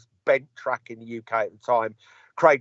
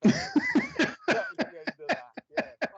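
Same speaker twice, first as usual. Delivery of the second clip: laughs through the words, slow and high; laughing; 5 dB quieter, much slower than usual. Real, not acted. Rueful laugh as he tells the host the coup ended. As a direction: let the laugh carry the line as it winds down.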